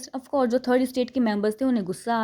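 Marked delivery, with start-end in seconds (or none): none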